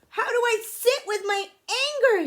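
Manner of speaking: high, loud frustrated yell; furious child. Acted. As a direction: cry it out in exasperation. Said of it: "I'm so angry".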